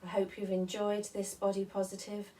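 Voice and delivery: normal talking voice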